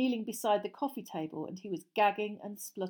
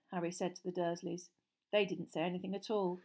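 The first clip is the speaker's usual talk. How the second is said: plain reading voice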